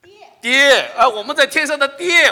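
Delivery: loud, high call of the dialect word for dad; Shaanbei dialect. Shown as a demonstration. Pastor echoing Shaanbei word for heavenly dad, loud dialect. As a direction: belt it out in a hammed-up Shaanbei dialect. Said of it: "陕北先".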